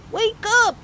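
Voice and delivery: shout. Acted. Bear waking kittens, urgent shout. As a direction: call them awake. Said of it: "he shouted to the kittens".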